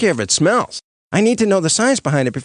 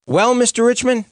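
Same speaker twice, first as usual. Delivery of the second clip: usual speaking voice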